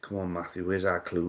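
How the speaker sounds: normal talking voice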